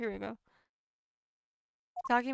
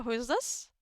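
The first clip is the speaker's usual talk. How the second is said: usual speaking voice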